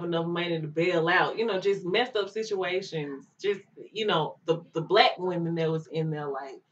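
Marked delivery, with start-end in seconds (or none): none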